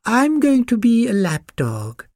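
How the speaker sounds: high, slow, self-important pug voice; snooty little lap dog, drawn out; lofty. A narrator's reading. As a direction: announce it grandly in a high voice. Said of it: "said the Pug loftily".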